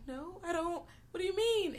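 confused high voice. Retold or imitated. Podcaster imitating the confused heroes, high and soft. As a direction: play it baffled and small. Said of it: "Everybody's like".

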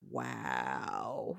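low, quiet fake surprise; deep flat wow. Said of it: "he's like".

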